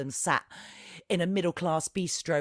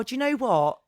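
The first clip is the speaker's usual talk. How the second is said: ordinary delivery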